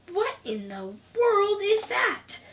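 high, slow, incredulous question; startled disbelief. Acted. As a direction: stretch it out in alarmed astonishment. Startled by noise at bedtime.

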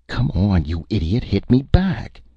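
high-pitched, urgent dare; acted exasperation, egging a partner on; impatient goading. A narrator's reading. Fania goads Donnaught into a staged fight, impatient.

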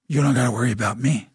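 low, lightly menacing warning; mock warning. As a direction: drop low for a playful threat. Mock warning to future son-in-law.

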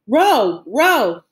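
urgent command. A narrator's reading. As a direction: drive the rowers on. Urging the escape boat onward.

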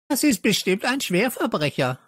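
high screechy accusation; shrieking. A narrator's reading. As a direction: screech it like a know-it-all girl. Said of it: "kreischte Stella wieder".

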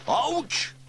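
high, loud sudden yelp; yelp of pain. Acted. Sam yelps as the bird grabs his nose. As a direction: yelp as the talons grip. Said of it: "yelled Sam".

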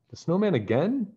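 high, startled question; gasping surprise. A narrator's reading. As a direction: gasp it out in alarm. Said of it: "Bess gasped".

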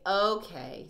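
loud, lightly acted agreement; Bella and Rosie. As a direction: give in aloud, as a storybook dog. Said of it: "said Bella and Rosie".

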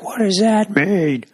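high, slow mocking old man voice; mimicking the grumpy downstairs neighbour, high and slow. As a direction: parrot him back in a high, drawn-out mock. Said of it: "he would just repeat it and be like".

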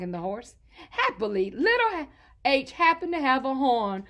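usual speaking voice

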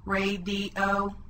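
monotone robot, three chopped pieces; robot speak. Shown as a demonstration. Teacher chops words into pieces, monotone robot voice. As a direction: chop it evenly like a robot. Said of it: "chopping the words into pieces".

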